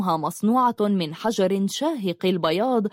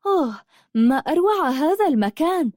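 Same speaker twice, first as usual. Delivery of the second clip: higher-pitched, wondering exclamation; amazed; 8 semitones higher than usual. A narrator's reading. Habiba marvels at the beauty of the castle, amazed. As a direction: voice lifted in awe at the sight. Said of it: "قالت حبيبة متعجبة من روعة القلعة".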